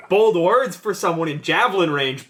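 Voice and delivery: calling out. Acted. Barbarian telling the devil off within javelin range, called out. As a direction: shout it up at the flyer. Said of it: "now I'm gonna tell him".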